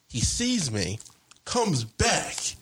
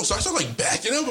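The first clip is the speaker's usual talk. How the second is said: ordinary talk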